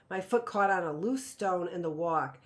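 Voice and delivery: plain reading voice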